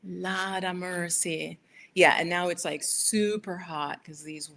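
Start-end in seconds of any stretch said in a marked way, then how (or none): 0.0-1.6 "Lord" as "Lawd", slow; slow, savouring "Lawd of mercy". Shown as a demonstration. Echoing her mother's exclamation, slow "Lawd". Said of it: "L A W D, not L O R D".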